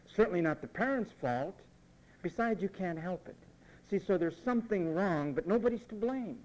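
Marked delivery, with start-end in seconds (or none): none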